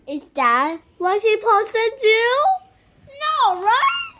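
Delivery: high, loud, crying-out question; loud high worried plea; excited worry. Real, not acted. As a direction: fret at the top of the voice.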